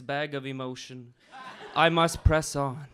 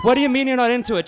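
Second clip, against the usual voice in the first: mock indignation; 8 semitones higher, 10 dB louder, much faster than usual. Poet indignant at the crowd.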